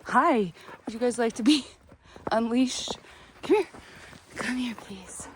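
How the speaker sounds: high sing-song voice for the hens; pet baby talk. Acted. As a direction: coo at the chickens like pets. Talks to her chickens.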